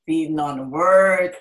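emphatic repeat. Real, not acted.

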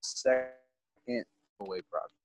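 choppy, cutting out connection; radio breaking up. Real, not acted. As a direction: voice dropping out over bad Wi-Fi. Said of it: "Yo, you're breaking up".

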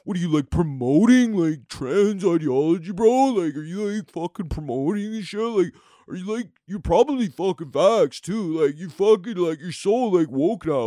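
lightly voiced dumb online bro, drawling; mocking angry commenter. Comic mocking an angry online commenter in a light dumb-bro voice. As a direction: sneer it like a riled-up anti-woke commenter. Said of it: "Are you one of these people going".

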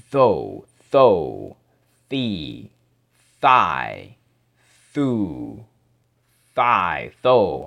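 slow, separate th syllables with pauses; teacher drilling th syllables. Shown as a demonstration. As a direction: drill th plus vowel sounds one by one. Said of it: "practice making these sounds".

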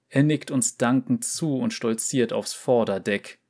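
ordinary delivery

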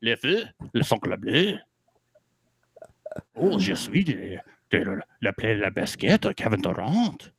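put-on Pepe Le Pew style French accent, lightly done; mock French accent. Host hamming Pepe Le Pew French to his co-host. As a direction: ham up a cartoon French skunk. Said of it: "yours sounds very Pepe Le Pew".

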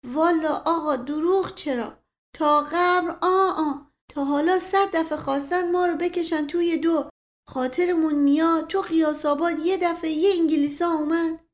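simple servant swearing he never lies; rambling servant. Acted. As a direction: launch earnestly into an old tale. Mashghasem begins a tale.

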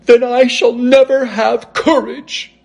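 high-pitched cowardly lion voice; lion, lamenting. A narrator's reading. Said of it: "declared the lion".

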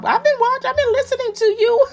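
high, fast teasing sing-song; mock-accusing the fans; laughing tease; playful tease.

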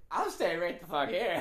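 loud, high-pitched retold panic; hammed-up frightened refusal; mock fear. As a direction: act scared, loudly refusing to budge. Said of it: "act like I was just afraid".

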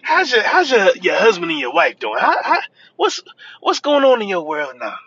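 high, eager catch-up questions; mock gushing. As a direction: imitate someone overdoing friendly concern, pitching way up. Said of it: "they're like".

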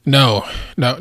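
usual speaking voice

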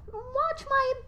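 high pleading voice; mother instructing. Retold or imitated. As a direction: ask the nymphs to watch him. Rhea instructing nymphs.

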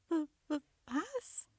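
high, startled stutter. A narrator's reading. Young gnome baffled by the talking monster, high stutter. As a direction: trip on the first sound, pitch leaping up. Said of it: "stotterte der junge Wichtel".

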